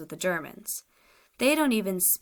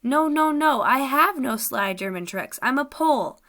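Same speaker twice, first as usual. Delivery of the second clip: higher and louder protest; earnest denial; 3 semitones higher, 4 dB louder than usual. Acted. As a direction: deny it hard, pleading to be believed. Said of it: "He spoke convincingly".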